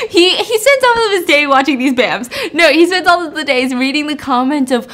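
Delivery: real laughter through the words, high and loud; laughing. Real, not acted. Storyteller amused by the retired actor's days, laughing. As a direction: crack up while talking. Storyteller cracking up mid-sentence.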